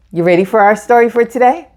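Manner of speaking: ordinary delivery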